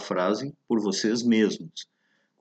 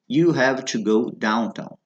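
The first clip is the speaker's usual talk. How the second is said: slow model phrase; slower than usual. Shown as a demonstration. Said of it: "quando se diz".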